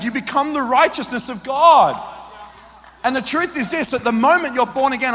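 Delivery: usual speaking voice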